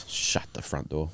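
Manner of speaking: hushed mock disbelief; whispering. Real, not acted. Mock shock at no bet.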